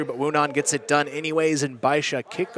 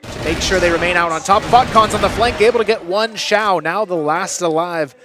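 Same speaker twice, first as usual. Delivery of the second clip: hyped shouting play-by-play, higher; esports caster; 4 semitones higher, 7 dB louder than usual. Real, not acted. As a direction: yell the kills as they land. Live play-by-play of a multi-kill.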